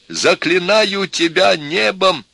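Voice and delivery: high, slow theatrical entreaty; imploring. A narrator's reading. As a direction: beseech her with all your heart. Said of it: "опять взмолился профессор".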